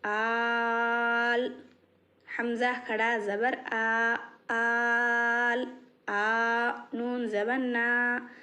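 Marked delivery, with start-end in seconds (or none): 0.1-1.6 आल with the aa drawn out long; slow elongated vowel; held long aa. Shown as a demonstration. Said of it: "आल नहीं पढ़ेंगे". 4.5-5.7 आल with the aa drawn out long; slow elongated vowel; held long aa. Shown as a demonstration. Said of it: "इसको पाँच अलिफ के बराबर खींचेंगे".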